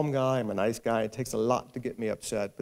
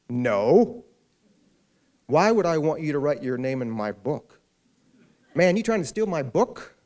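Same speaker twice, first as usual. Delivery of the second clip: loud, higher, hostile stranger; suspicious rebuff; 4 semitones higher, 7 dB louder than usual. Retold or imitated. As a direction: push him away loudly, half laughing at the end. Stranger rebuffs author at airport.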